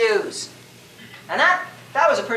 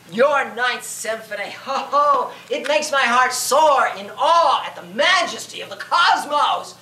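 mock critic; 3 semitones higher, slower than usual. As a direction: parody a gushing but dismissive critic. Mock critic addressing Beethoven.

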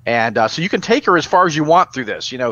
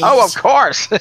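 laughing; 7 semitones higher, slower than usual. Real, not acted. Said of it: "laughing reply".

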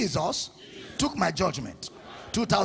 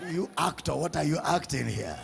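amused dismissal; 6 semitones lower, 8 dB quieter than usual. Acted. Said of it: "God will just laugh and say".

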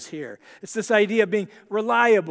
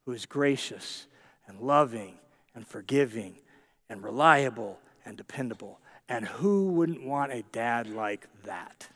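much lower and softer, gentle and intimate; soft tender close; 9 semitones lower, 5 dB quieter than usual. Real, not acted. Preacher softly assuring listeners of God's kindness. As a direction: bring it down low and soft, almost confiding.

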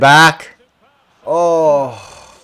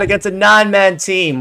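ordinary talk, nothing marked

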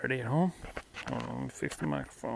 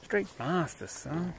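excited; 7 semitones higher, 3 dB louder, slower than usual. Real, not acted. Hyped repeat of monsters.

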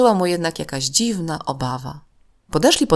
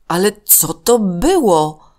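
put-on slow, higher, puzzled boy's voice; Paweł's question; 4 semitones higher, 5 dB louder, slower than usual. A narrator's reading. Paweł asking his friend what just happened, slow and puzzled. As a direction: ask it slowly, baffled. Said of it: "zapytał się Paweł".

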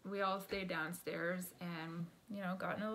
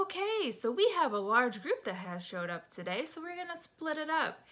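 organiser's announcement; 8 semitones higher, 7 dB louder than usual. Retold or imitated. Acts out hostess announcing the split.

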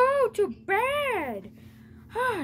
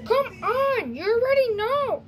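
ordinary talk

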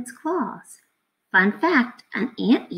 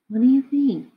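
ordinary delivery, nothing marked

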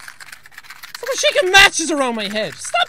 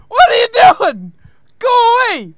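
loud, high panicked yell at the game; loud frightened yelling; 7 semitones higher, 8 dB louder than usual. Real, not acted. Scared player yelling at game.

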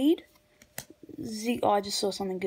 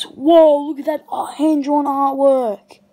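loud, raised burst of amazement; excited awe; 3 semitones higher, 8 dB louder than usual. Real, not acted. Collector spotting hand-drawn card artwork, excited awe. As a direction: marvel out loud, voice jumping up.

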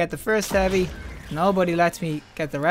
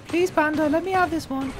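high, wheedling beg; pleading; 10 semitones higher than usual. Real, not acted. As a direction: whine sweetly to win the favour. Begs teammate for the heavy.

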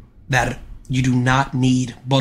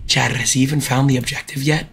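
ordinary talk

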